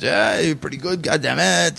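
imitating a French-Canadian accent, excited and high. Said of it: "you know, in the French accent".

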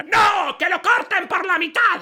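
high shouted demand; spiteful mother-in-law. Acted. The other mother-in-law demands he be cut, high and loud. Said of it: "dijo la otra señora".

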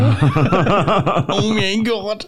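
high, drawn-out mock gasp of awe; fanboy gushing. Comedian showing how he fanboys, high gushing gasp. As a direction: gasp and squeal like a fan. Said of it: "I still fanboy over comedians".

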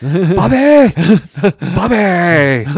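high, loud, drawn-out mock holler; hammed-up shout for Bubby; imitated calling out. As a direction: holler the name, stretching the last syllable. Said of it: "That's how he".

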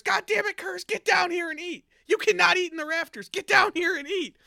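high, loud furious scolding; angry yelling. Retold or imitated. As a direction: order him down from the rafters. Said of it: "the Emperor's yelling at Kurz".

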